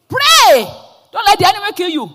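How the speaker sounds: high, loud commanding cry; forceful shout. Retold or imitated. Friend pastor commanding the weeping woman to pray, loud shout. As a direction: shout the order to pray like a pastor rousing her.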